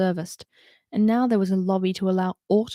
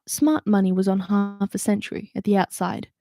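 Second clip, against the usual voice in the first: ordinary delivery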